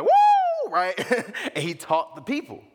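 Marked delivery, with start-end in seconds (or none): 0.0-0.7 very high, very loud whoop; shepherd calling his flock, loud high whoop; shepherd call woo. Retold or imitated. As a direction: let out a huge high whoop. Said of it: "he was like".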